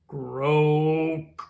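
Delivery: a big froggy croak; booming toad croak; loud croak. Acted. Toad sound effect in story.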